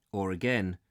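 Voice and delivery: ordinary delivery, nothing marked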